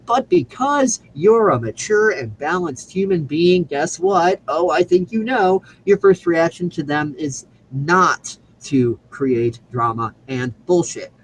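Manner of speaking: drawn-out, emphatic refrain with a weighty "not"; slow, pointed repetition. Real, not acted. Speaker repeating the rule listeners already know, slow and pointed. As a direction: spell it out slowly, as if they know it already.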